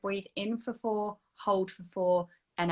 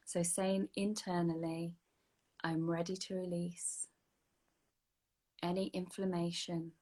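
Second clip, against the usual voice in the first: guided meditation; 5 dB quieter than usual. Acted. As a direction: lead the breath gently, unhurried. Leads release meditation.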